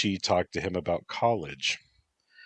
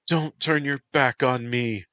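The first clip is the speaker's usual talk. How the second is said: louder, higher call after him; 7 semitones higher, 5 dB louder than usual. Acted. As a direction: call out to stop him leaving. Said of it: "her voice called out".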